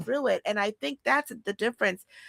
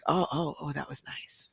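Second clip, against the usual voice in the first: soft, melting, barely voiced sigh of pleasure; hushed swoon; 4 semitones lower, 9 dB quieter than usual. A woman answers a caller's voice, hushed and swooning. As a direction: let it trail away, charmed and weak-kneed.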